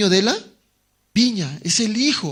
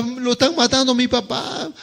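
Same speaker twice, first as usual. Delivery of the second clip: higher, panicked cry; anguished cry; 6 semitones higher than usual. Acted. Son cries out as his father is killed, higher and panicked. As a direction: call out in horror. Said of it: "Y uno grita".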